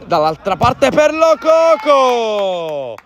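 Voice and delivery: drawn-out rising goal shout; excited goal call. Real, not acted. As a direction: stretch the score out, thrilled. Live futsal goal call. (telecronaca gol entusiasta)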